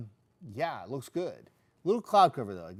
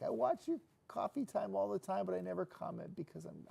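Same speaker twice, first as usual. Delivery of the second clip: higher-pitched imitation of a shy woman; shy lady; 7 semitones higher than usual. Host retelling a shy viewer's confession, lightly imitated. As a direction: lift the voice to play a timid viewer. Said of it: "she was really shy".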